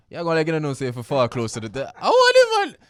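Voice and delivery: teasing, rising outburst; playful excited rant. Real, not acted. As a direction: rile up the co-host.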